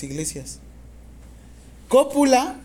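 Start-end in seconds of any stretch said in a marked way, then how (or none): 1.9-2.6 loud, slow dictation in chunks. Real, not acted. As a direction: dictate slowly and clearly, projecting to the class. Teacher dictating exam question to students.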